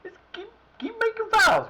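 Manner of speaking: high, loud fed-up cry; exasperated outburst. Real, not acted. Gamer yelling at his team's fouls, exasperated and loud. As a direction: throw up your hands at the fouls. Furious at team fouling.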